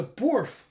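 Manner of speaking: high, loud spoken woof; mock dog bark. Dog barks.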